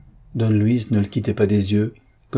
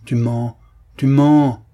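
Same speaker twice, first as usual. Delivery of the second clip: accusation rising to a loud, higher shout; uncontained cry; 3 semitones higher, 6 dB louder, slower than usual. A narrator's reading. Officer accuses the old man of lying, uncontained cry. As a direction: cry out, losing control on the repeat. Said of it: "s'écria l'officier, incapable de se contenir".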